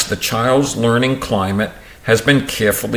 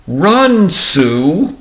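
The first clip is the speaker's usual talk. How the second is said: put-on high, raised call to a child; primer mother calling; 7 semitones higher, 4 dB louder than usual.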